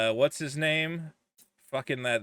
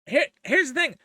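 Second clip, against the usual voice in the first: mock reasoning; 6 semitones higher, 3 dB louder than usual. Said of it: "part of me goes".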